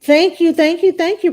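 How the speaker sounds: loud, high, gushing thanks; excited shout-out. Real, not acted. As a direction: burst out with thanks to a viewer.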